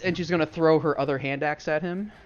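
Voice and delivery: ordinary talk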